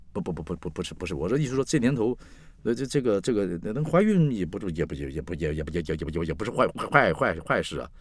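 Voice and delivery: stuttering, tripping over repeated words; flustered stammer. Acted. Man backtracking after asking about pregnancy, flustered stammer. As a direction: backpedal nervously, stuck on the same syllables. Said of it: "我都结巴了".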